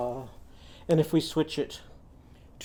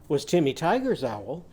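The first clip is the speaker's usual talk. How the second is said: usual speaking voice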